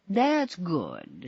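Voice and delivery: plain reading voice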